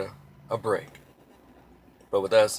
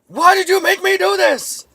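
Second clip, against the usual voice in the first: very high, loud screamed plea; mock cop scream; 11 dB louder than usual. Minnesota cop screaming after shooting, high loud wail. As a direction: scream it like the cop who just fired. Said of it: "is screaming and yelling".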